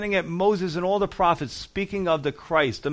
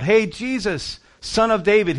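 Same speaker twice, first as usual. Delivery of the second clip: raised, higher-pitched calling out; imitated shout; 5 semitones higher, 4 dB louder than usual. Blind man calling to passing Jesus, imitated shout. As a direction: call out as if to someone passing, half-shouted. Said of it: "he just shouted out".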